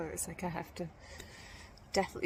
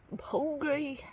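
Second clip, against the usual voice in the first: higher, louder, half-laughing self-pity; mock whiny complaint; 9 semitones higher, 4 dB louder than usual. Hiker retelling her excuses to the talker, mock whiny. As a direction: whine it like a tired kid. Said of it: "And I just was like".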